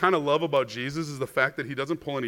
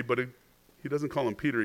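normal talking voice

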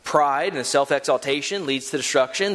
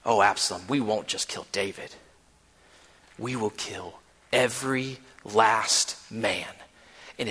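low menacing voice; 7 semitones lower, 5 dB quieter than usual. Retold or imitated. Hushai tempting Absalom with violence, low and menacing. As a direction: lean in low, letting the threat sink in.

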